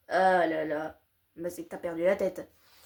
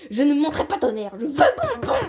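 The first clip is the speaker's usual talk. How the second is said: spoiled refusal; 4 semitones higher, 7 dB louder, faster than usual. Acted. Spoiled child refusing loudly.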